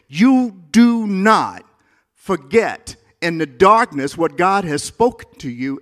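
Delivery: slow, loud, high preacher's drive; loud slow build from the pulpit; preaching emphasis. Real, not acted. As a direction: hammer each word home. Preacher drives home a point.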